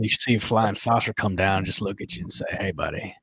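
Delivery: laid-back McConaughey telling a strange tale; Matthew McConaughey impression. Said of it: "I was doing my best Matthew McConaughey".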